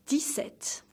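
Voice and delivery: "dix-sept" as dee-SET, x silent, p silent; careful "dix-sept" with no p heard; model French pronunciation. Shown as a demonstration. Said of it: "Say it more like".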